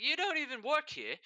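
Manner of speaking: put-on high, stunned Jamaican-accented question; a light Jamaican accent, surprised. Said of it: "Jamaican accent".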